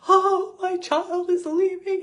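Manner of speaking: high, loud, slow tragic wail; tragic movie lament. Retold or imitated. Mother imagining her son leaving, high loud tragic wail. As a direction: play it like the swelling movie finale. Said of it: "I'm off into the future of".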